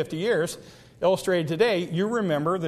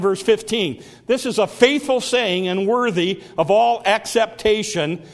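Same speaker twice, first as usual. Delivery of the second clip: raised, driving pulpit delivery; emphatic preaching; 4 semitones higher, 3 dB louder than usual. Real, not acted. Preacher urging the congregation to measure themselves by Christ, emphatic preaching. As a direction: preach it higher and firmer, leaning on key words.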